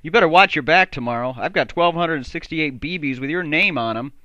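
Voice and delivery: loud threatening call; shouting. Acted. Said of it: "and shouted".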